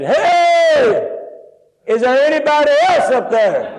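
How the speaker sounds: high, long-held shout; calling out. Retold or imitated. Doubting man looks for another rescuer above, hollering. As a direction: holler upward for someone else, stretching it out. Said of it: "and he said".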